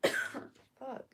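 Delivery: loud cough and a cursed fuck; hack, then swear at it; cough then curse. Real, not acted. Sick reader coughs loudly and swears. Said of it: "my chest screams at me".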